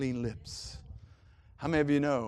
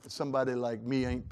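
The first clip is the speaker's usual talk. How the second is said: usual speaking voice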